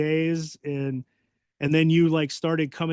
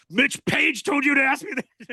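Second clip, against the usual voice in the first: Bull caught off guard; 10 semitones higher, 3 dB louder than usual. Retold or imitated. Bull realizing who asked.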